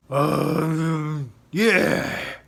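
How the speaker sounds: a big beast agreeing; dragon voice. Acted. Montjoy the dragon answering his rider, loud creature voice. The dragon replies under fire.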